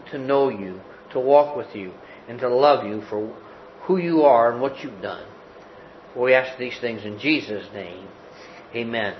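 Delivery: soft, low prayer voice. Acted. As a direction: pray it gently and reverently. Closing prayer before sermon.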